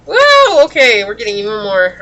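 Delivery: high, loud whoa of surprise; surprised. Real, not acted. More cards fall out.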